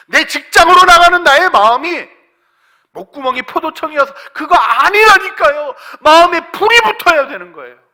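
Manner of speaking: very high, shouted pulpit exhortation; full-force preaching. Real, not acted. Preacher urging congregation to burn in spirit, full-force shouted preaching. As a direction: cry out to set their hearts on fire.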